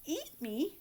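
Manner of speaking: a hint of a frightened little goat; trembling. A narrator's reading.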